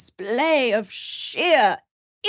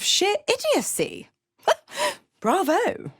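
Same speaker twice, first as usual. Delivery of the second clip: high-pitched, laughing mockery; derisive, high-voiced bravo; scornful amusement; 11 semitones higher than usual. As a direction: laugh at them, then sneer the congratulation.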